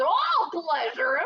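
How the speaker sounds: loud, slow drawn-out yell. Acted. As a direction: stretch out the taunt as a shout. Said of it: "he yelled".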